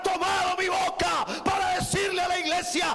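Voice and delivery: high, shouted pulpit proclamation; full-force preaching. Real, not acted. Preacher speaking for the Spirit to the church, full-force preaching. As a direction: cry it out, stretching the final phrases.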